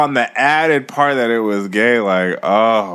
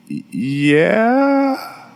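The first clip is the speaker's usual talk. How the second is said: high, drawn-out uncertain yeah; hesitant yes; 10 semitones higher than usual. Retold or imitated. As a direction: stretch it upward as if suspicious of the offer. Hesitant answer to mother.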